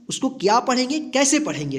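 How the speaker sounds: ordinary talk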